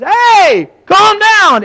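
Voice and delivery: high, very loud bellow; full-force furious command; angry shout. Acted. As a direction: yell at the weather to shut up. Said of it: "he shouts to it".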